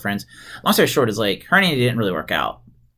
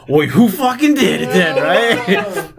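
higher, louder, slower, laughing through the words; laughing mock anger; 7 semitones higher, 6 dB louder, much slower than usual. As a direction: play the angry wife but crack up laughing. Quoting angry Kim while laughing.

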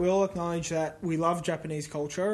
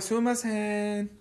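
softer, slow, drawn-out Japanese courtesy; understated shop thanks; 5 dB quieter, slower than usual. Shown as a demonstration. Correcting how you'd say it leaving a shop, soft drawn-out courtesy. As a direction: say it softly and slowly, stretched out. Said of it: "It's more like".

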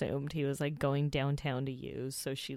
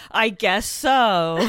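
deadpan; 6 semitones higher, 14 dB louder, much slower than usual. Retold or imitated. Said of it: "And then Matt just goes".

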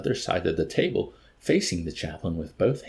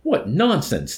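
loud, high indignant dismissal; annoyed declaration; 10 semitones higher, 6 dB louder, slower than usual. A narrator's reading. Colonel turning from the chaplain, indignant declaration. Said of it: "declared the red-faced colonel".

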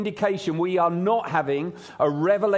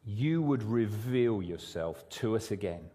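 prayer; 5 semitones lower than usual. Real, not acted. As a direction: pray it gently and sincerely. Pastor prays aloud before sermon.

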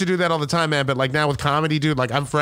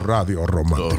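very low, sultry Spanish radio voice; romantic radio announcer; 12 semitones lower than usual. Pretend. Comic joking about getting all romantic, deep radio-DJ voice. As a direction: croon it deep like a late-night DJ.

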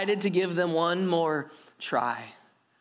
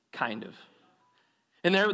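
ordinary talk

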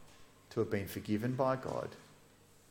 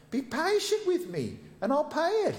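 pleading. Acted. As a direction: plead with him, pitched way up. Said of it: "he pleaded".

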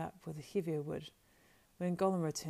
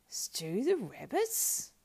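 high, slow creature squeal; dismayed squeal; 6 semitones higher, 4 dB louder, much slower than usual. A narrator's reading. Gollum hears Sam will stew rabbits, squeals in dismay. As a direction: squeal it in disbelief. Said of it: "squealed Gollum in dismay".

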